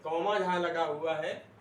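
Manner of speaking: ordinary talk, nothing marked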